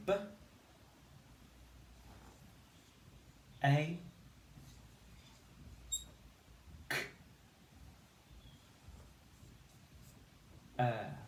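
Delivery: "B, A, K, er" as separate phonemes with long pauses; sounding out baker. Shown as a demonstration. Teacher segmenting baker for pupils. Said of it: "And we say".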